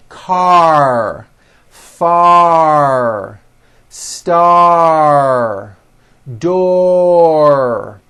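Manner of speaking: slow drawn-out words, each closing on a held R; strong final R. Shown as a demonstration. Teacher says repeat after me, each final R long and strong. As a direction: stretch "Far", "Star", "Door" into a firm final R. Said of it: "The R needs to be very strong".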